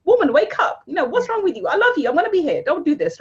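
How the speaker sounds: scolding best friend. Retold or imitated. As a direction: shake her awake like a blunt best friend. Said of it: "slap you and say".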